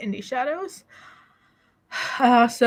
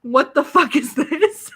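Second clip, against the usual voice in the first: shocked laughing disgust; 3 semitones higher, 4 dB louder, slower than usual. Retold or imitated. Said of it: "you're like".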